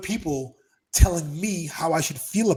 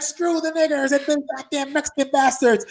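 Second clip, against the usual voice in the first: much higher, louder put-on bigot voice; mock bigot; 10 semitones higher, 7 dB louder than usual. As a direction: spit it like the flag-waving guy. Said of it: "He's like".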